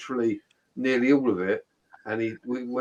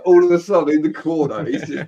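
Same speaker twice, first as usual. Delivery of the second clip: normal talking voice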